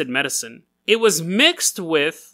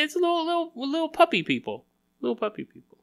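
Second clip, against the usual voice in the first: squeaky-soft gushing, trailing quieter; cutesy cooing; 9 semitones higher, 7 dB quieter than usual. Real, not acted. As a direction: coo over something tiny and adorable. Cooing over adorable furry kids.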